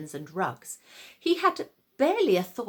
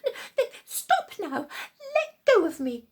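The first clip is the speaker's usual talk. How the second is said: high, stammering, half-laughing push-away; alarmed protest; 11 semitones higher, 3 dB louder than usual. Acted. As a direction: squirm free, growing alarmed. Luba pushes the Saracen off.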